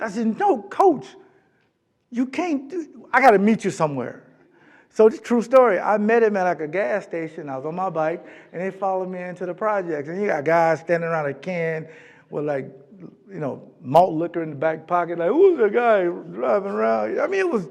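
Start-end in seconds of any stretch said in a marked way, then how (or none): 0.4-4.2 urgent warning. Retold or imitated. Urgently warning coach off projects. 15.3-17.2 suspicious street guy. Retold or imitated. Guys in projects challenging stranger.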